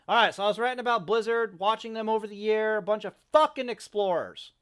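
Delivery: loud, fast ranting restart. Real, not acted. Vlogger resuming his rant about Blizzard, loud. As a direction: launch back into the rant. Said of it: "let me keep ranting here".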